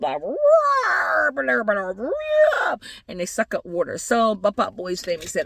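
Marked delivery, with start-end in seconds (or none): 0.2-2.8 high, drawn-out mock trumpet calls; elephant trumpeting. Storyteller shows how elephants raise trunks, trumpeting. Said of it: "they take their little trunks up".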